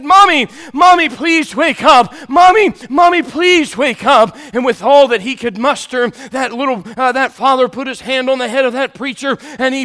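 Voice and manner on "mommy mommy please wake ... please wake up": loud high cries, slow on please wake up; child crying out. Retold or imitated. Little boy shaking the coffin, shouting for Mommy. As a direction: call out desperately to wake her. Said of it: "and he said ... shake that coffin".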